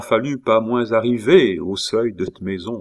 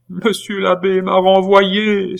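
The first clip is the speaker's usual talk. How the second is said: high, slow, loud acted wail; bursting into sobs; 11 semitones higher, 6 dB louder, slower than usual. A narrator's reading. Nephew confessing his dismissal to his aunt, wailing. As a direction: cry it out as a sobbing boy. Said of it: "en éclatant d'énormes sanglots".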